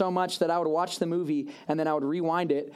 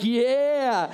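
pumped-up yeah; 6 semitones higher, 5 dB louder than usual. Retold or imitated. Excited reaction to movie line.

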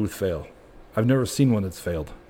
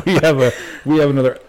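laughing; 4 semitones higher, 6 dB louder than usual. Real, not acted. Still laughing after joke.

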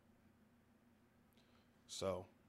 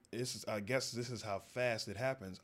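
ordinary delivery

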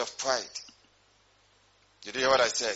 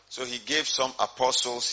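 ordinary delivery

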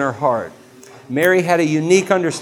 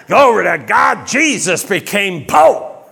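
TV preacher impression; 3 semitones higher, 4 dB louder than usual. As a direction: ham up a prosperity preacher's boast. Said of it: "You ever hear those T V preachers?".

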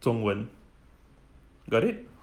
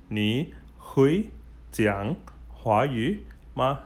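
slow, word-by-word question; slow model question; much slower than usual. Shown as a demonstration. Teacher shows beginners how to ask, slow and separated. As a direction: space out each word for learners to copy.

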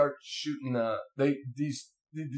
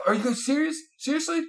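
high-pitched disbelieving questions; incredulous; 11 semitones higher than usual. Retold or imitated. Vince asks the wounded men if they mean it, incredulous. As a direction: rise higher in mock disbelief.

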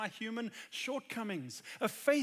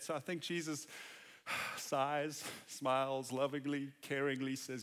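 ordinary talk

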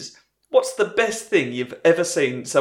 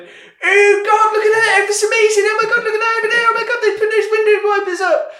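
excited squeal; 7 dB louder than usual. Retold or imitated. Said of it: "the group of them just went".

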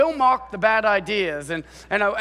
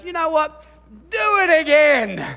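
cheeky command; 8 semitones higher, 5 dB louder than usual. Retold or imitated. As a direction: boom it out like a cocky prophet. Elijah ordering more water.

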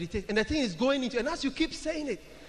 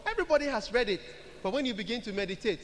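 ordinary delivery

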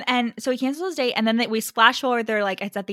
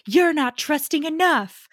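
higher, louder, slow comeback; emphatic retort; 6 semitones higher, 6 dB louder, slower than usual. Retold or imitated. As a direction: fire back with mock-earnest weight. Acting out her retort.

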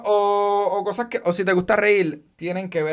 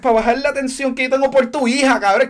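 mock scolding; 5 semitones higher, 4 dB louder than usual.

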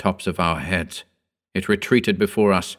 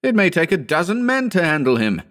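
high, agitated outcry; shouting; 10 semitones higher than usual. A narrator's reading. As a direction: exclaim it urgently with the pitch way up. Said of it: "Howard shouted".

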